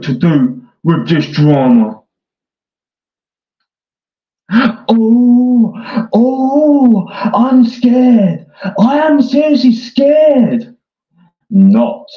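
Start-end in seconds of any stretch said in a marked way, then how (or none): 4.5-10.8 high, hammed-up fake fear with a gasp; mock scared. Sarcastically feigning fear at rival. 11.5-12.1 deadpan not. Real, not acted. Drops the act, deadpan.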